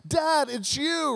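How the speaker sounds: high, slow, wide-eyed plea; hopeful Buddy. Retold or imitated. Buddy the Elf recognising his father, high and slow. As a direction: stretch it out with childlike hope. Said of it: "Buddy's like".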